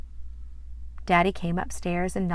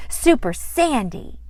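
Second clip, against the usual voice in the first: proud announcement; 6 semitones higher, 6 dB louder than usual. Acted.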